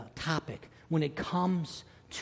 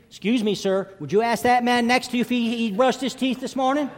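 put-on high, raised little boy's voice; insistent boy; 8 semitones higher, 4 dB louder than usual. Boy has Stan ask the next man, high and insistent. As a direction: call across the seats, high and insistent.

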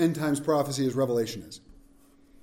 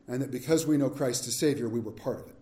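normal talking voice

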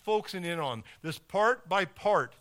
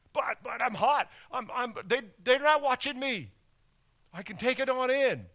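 higher, eager voice, stammering to stay in the game; protesting player; 6 semitones higher than usual. Pretend. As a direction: plead it like a player on a hot streak. Hot player argues with coach.

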